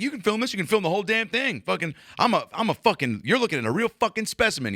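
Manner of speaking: high, mocking cop voice; cocky aggressive cop. Cop daring the kid to film him, cocky. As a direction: play a worked-up cop boasting for the camera. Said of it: "He's like".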